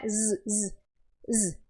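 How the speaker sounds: a soft voiced bee buzz, zzz. Shown as a demonstration. Teacher demonstrates the Portuguese Z sound: soft buzzing. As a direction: hum the Z like a little bee. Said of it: "aquele som da abelhinha".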